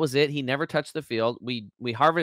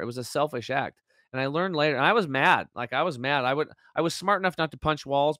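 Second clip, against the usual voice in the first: ordinary talk, nothing marked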